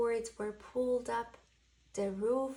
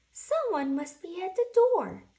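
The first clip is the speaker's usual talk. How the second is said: a hint of Owl calling to the door, higher and louder; 8 semitones higher, 11 dB louder than usual. Owl hears a noise, a light call to the door. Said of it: "said Owl".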